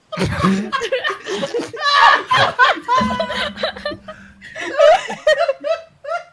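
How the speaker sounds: high, loud rising howl of laughter; howling laugh after the nose boop; loud shrieking laughter. Real, not acted. Player cracks up at boop.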